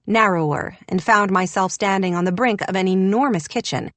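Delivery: ordinary delivery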